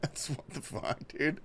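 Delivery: breathless laughing, slow; laughing whisper. Real, not acted. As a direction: giggle the words out. Reacting to a pinned chat message.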